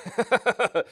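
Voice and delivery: a short burst of high laughter; amused laugh. Real, not acted. Co-host laughs at Bronx's union advice, bursting out high.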